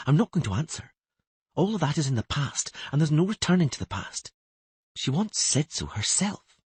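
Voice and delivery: lightly voiced girl thinking; lightly voiced young girl. A narrator's reading. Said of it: "thought Ciri".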